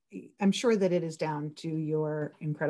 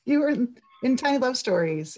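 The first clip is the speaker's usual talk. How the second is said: much higher, gushing fan who recognises you; hammed-up starstruck acquaintance, higher and louder; excited fan; 9 semitones higher, 6 dB louder than usual. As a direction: squeal it like someone spotting a minor celebrity. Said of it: "came over and said".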